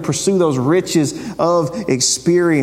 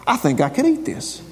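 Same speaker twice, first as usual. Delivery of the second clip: mock naive eater; 8 semitones higher than usual. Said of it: "was like".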